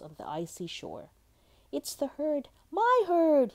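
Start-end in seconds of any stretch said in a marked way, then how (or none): 1.7-3.5 high, loud, delighted cry; cried in delight. A narrator's reading. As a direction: build to a joyful shout. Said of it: "he cried in delight".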